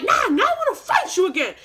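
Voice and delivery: loud, high-pitched angry shout; re-enacted fighting-mad yell; screaming. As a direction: yell it like you're going back in. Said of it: "And I'm screaming".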